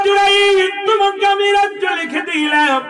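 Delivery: high, ringing declamation; full-force preaching. Acted. King grants his kingdom to Jurain, high impassioned proclamation.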